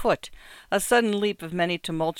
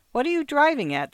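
suspicious; 10 semitones higher, 4 dB louder than usual. A narrator's reading. Dealer suspicious of Markheim.

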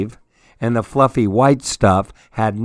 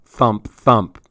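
ordinary delivery, nothing marked

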